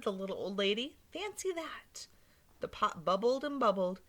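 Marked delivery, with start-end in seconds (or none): 1.1-2.1 high whispered marvel; old lady's hushed amazement; whispered wonder. Acted. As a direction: breathe it in awe.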